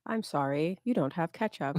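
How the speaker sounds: soft polite assistant voice; calm apologetic AI voice; Siri voice. Retold or imitated. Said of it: "gonna sound like Siri".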